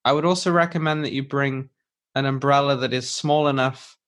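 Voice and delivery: ordinary talk, nothing marked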